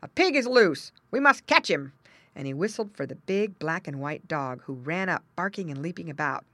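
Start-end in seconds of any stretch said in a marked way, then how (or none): 0.0-1.9 loud, raised urgent call; calling a dog. A narrator's reading. Farmer sends dog after pig, calling out. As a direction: rouse the dog to the chase. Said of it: "he called to his dog".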